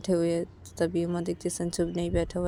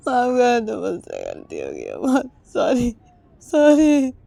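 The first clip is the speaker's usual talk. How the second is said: tearful apology; 3 semitones higher, 8 dB louder, slower than usual. Acted. Sanju hugging, crying, apologizing.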